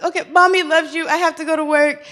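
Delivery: put-on high, gentle voice for a crying child; soothing mommy voice. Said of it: "I'm like".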